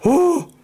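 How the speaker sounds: sharp, high whispered gasp of fright; a sudden frightened breath in; startled gasp. Real, not acted. Startled by ticking in the room.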